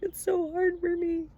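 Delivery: whiny human. Acted. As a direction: squeal it like a stubborn child who won't listen. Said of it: "And we're like".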